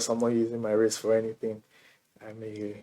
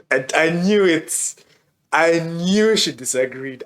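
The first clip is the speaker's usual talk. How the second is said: triumphant i-knew-it; 6 semitones higher, 5 dB louder than usual. Real, not acted. Teasing friend who disagreed.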